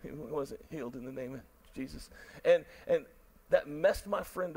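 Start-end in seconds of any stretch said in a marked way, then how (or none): 0.0-2.1 quiet and sober. Real, not acted. Pastor to the congregation after the failed healing, quiet and grave. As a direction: let it land softly and heavily.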